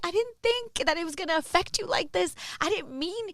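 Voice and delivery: high-pitched, imploring retold apology; hammed-up remorseful pleading. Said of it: "when people are apologizing to you".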